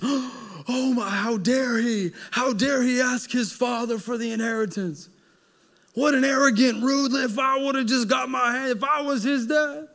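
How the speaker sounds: high, indignant mock tirade; outraged listener. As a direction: huff like a scandalized elder.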